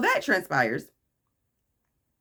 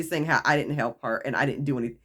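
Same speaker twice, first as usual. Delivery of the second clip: ordinary talk, nothing marked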